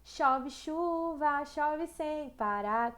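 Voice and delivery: very slow, each word drawn out; slow song line. Shown as a demonstration. Teacher slowly recites a song line to show the repeated ch. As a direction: stretch it out so every ch sound is heard. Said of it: "outra letrinha ali de música".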